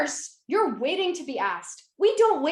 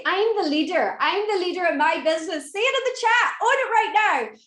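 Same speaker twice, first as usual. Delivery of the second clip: higher and louder, pumping up the crowd; rallying call; 6 semitones higher, 6 dB louder than usual. Real, not acted. Urging the ladies in the chat to own it, loud. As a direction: call it out like a hype coach.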